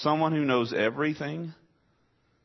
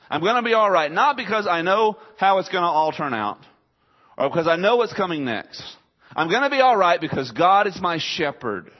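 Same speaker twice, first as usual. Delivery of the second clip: higher and louder, bold proclamation; David's declaration; 5 semitones higher, 5 dB louder than usual. Acted. Pastor voicing David's trust in his shepherd, higher and louder. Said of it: "David is saying this".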